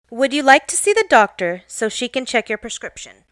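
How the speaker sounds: ordinary talk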